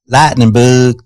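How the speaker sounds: imitating an Alabama toddler's drawn-out Southern twang; Alabama accent. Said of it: "that is an Alabama accent".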